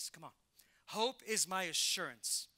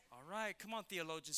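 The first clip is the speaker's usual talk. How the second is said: ordinary talk, nothing marked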